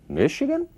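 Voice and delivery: "Michigan?" pitched very high, rising like a question. Shown as a demonstration. Teacher shows how Michigan becomes a question, interrogative tone. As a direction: lift "Michigan?" sharply into a high, querying rise. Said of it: "é um tom interrogativo aí".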